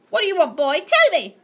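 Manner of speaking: very loud, high shout; thundering. Acted. Aunt Greta thundering at the boy, loud and high. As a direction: roar the demand at him. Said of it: "she thundered".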